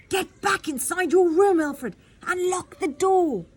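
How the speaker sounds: high, loud commanding shout; urgent shout. Acted. Queen ordering her son to lock himself in, urgent shout. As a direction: order him back inside, urgently. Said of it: "she shouted back".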